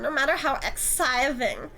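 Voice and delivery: usual speaking voice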